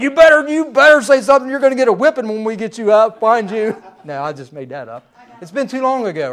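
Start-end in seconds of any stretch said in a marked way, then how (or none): 0.0-3.8 mad mother. Retold or imitated. Preacher voicing his wife calling angrily for the hiding girl. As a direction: snap it out like a worried mom losing patience. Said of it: "she was getting mad".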